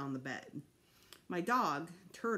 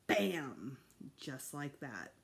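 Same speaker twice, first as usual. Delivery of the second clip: ordinary delivery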